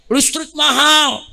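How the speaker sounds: slow, drawn-out mock wail; imitating a mother complaining. Preacher mimics housewives protesting power prices, slow mock wail. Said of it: "Ada lagi ibu-ibu teriak nih".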